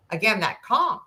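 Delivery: normal talking voice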